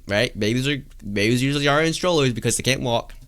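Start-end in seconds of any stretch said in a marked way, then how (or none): none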